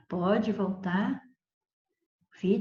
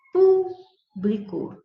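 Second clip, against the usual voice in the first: "Público" slow and louder, with the stress on "Pú"; stressed first syllable; 6 semitones higher, 6 dB louder, much slower than usual. Shown as a demonstration. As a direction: draw out "Público", leaning hard on "Pú". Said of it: "Aqui eu tenho proparoxítona". ("Público" devagar, com força na sílaba "Pú")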